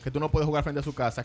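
usual speaking voice

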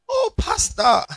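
very high, loud gushing "Oh, pastor"; fawning member sucking up to the pastor; mock flattery. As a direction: gush like a fawning church member.

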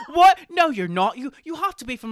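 high, loud incredulous protest; hammed-up outraged surprise; shocked disbelief. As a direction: act out a stunned British family. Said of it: "they were like".

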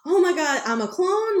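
mock panic, voice jumping up; retold freak-out. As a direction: clone freaking out over being a clone. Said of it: "the clone is freaking out".

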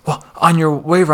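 mock snippy parent objecting; mock indignant mother. Voicing the angry mother.

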